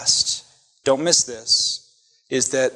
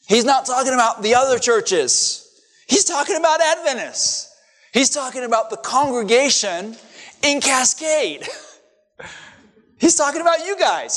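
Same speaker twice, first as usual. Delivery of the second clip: rising higher, pressing each "He's talking about" harder; fiery preaching; 9 semitones higher than usual. Real, not acted. Preacher telling the congregation it's about them, climbing fervor. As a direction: build the accusation to a peak, pitch climbing.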